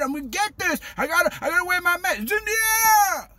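high-pitched hammed-up alarm; mock panic. Mimics panicked mask wearer.

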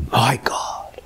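whispered, breath-only plea; hushed cry. Retold or imitated. Preacher voicing Jesus on the cross, whispering. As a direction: whisper it as a forsaken prayer.

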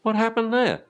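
high, bewildered little-dog voice; puppy voice. Retold or imitated. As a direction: play the baffled pup at the shut door. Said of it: "the little pup's looking at the door like".

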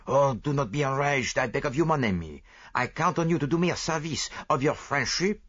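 put-on fussy Belgian detective, lightly French-accented; lightly voiced proud Belgian sleuth; Poirot voice. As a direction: play the vain little detective sure of himself. Poirot soothing Hastings.

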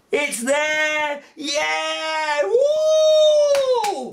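very high, loud whoops; host cheering the vanished cube with the kids. Pretend. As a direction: celebrate like a kids' show host.

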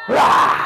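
long, voiceless lion "rah"; rough, breathy roar. Acted. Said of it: "when I say lion, can you go".

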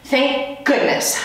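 high, slow sarcastic retort; snapping back. Acted. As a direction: fire it back with scorn. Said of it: "Heidi shot back".

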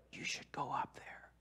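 slow whisper, nudging him forward; whispered urging. Retold or imitated. Friends whispering to him to go up for prayer. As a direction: hiss it under the music.

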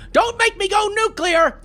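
high, slow warning; threat. Real, not acted. Gamer warning SpongeBob, high slow mock menace. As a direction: warn him with mock menace.

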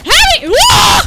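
real high-pitched panicked shout; terrified scream. Real, not acted. Gamer attacked from behind, terrified scream. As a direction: scream loudly for help. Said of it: "That was terrifying".

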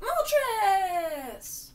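high, excited cry of the name; excited shout. Real, not acted. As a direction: call it out thrilled. Excited at spotting legendary.

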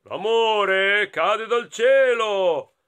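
slow, loud, stately tree voice; mighty tree. A narrator's reading. Tall tree answering the three friends, loud slow voice. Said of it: "risponde l'albero alto e possente".

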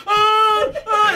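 high, comic "ah" yelps; hammed-up running scream; mock fleeing scream. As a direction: imitate a man fleeing in panic. Imitating the friend running away screaming.

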